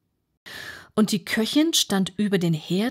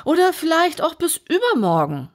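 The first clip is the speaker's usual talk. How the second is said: high, unflustered footman voice; unbothered footman; 11 semitones higher, 5 dB louder, faster than usual. Acted. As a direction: carry on as if nothing happened. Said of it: "fuhr der Lakai im gleichen Tone fort".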